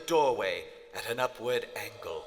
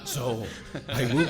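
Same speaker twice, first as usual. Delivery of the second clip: laughing madly; 3 semitones higher, 6 dB louder than usual. Acted. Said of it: "This demonic laughter".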